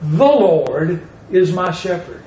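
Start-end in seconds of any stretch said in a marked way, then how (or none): 0.0-1.1 "The" drawn out before a falling "Lord"; deliberate weight on "The"; stress on THE. Shown as a demonstration. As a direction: press slowly on "The". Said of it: "emphasize the word "the".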